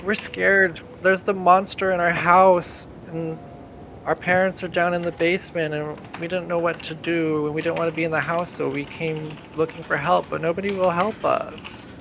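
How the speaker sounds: put-on high, pleading child's voice, near tears; a frightened girl of eight begging strangers for help; scared little girl. Girl begging the adventurers for help, high and frightened. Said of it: "and she says".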